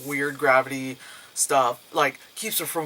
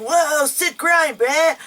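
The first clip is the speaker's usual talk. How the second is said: stoked skater bro; 11 semitones higher, 4 dB louder, slower than usual. Retold or imitated. Mocking the kids' skate talk.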